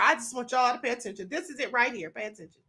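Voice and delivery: ordinary delivery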